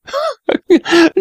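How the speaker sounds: high-pitched scornful laugh; mocking laughter. A narrator's reading. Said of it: "he was like to die of laughing".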